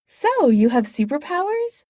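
bright, eager question; chirpy and upbeat. Acted. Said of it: "said Jan brightly".

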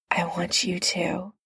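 soft breathy whisper, close; breathed whisper. Acted. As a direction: breathe it against his lips. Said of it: "he breathed against Steve's lips".

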